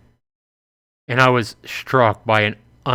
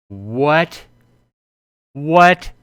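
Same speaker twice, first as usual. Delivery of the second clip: high, loud stunned disbelief; shocked what; 5 semitones higher, 6 dB louder than usual. Real, not acted. Player stunned at the plot twist, shocked exclamation. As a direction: blurt it out in total shock.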